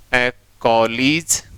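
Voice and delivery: कॉलेज very slowly, ending kolij; correct pronunciation. Shown as a demonstration. Teacher giving the class the correct कॉलेज, very slowly. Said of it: "सही उच्चारण क्या हो जाएगा".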